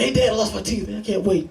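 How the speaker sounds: put-on high-pitched eager little-boy voice; excited kid. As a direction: imitate a child bursting with news. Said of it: "He's all excited about it".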